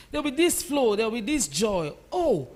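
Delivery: ordinary talk, nothing marked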